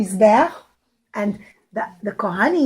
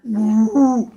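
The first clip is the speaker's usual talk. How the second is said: plain reading voice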